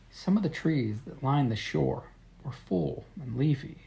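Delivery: quiet, gentle letter reading; soft storytelling. A narrator's reading. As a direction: read it softly, close to the mic.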